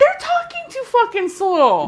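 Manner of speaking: high-pitched exasperated outburst; exasperated rant. Real, not acted. Rant about slow podcasters.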